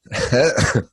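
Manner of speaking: laughing through a cough. Real, not acted. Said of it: "cough and laugh at the same time".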